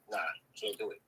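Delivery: usual speaking voice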